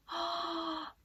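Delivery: quick high gasp of delight; a real gasp of delight; sharp gasp. Real, not acted. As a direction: gasp in at something lovely. Delighted at a book page.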